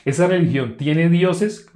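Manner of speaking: ordinary talk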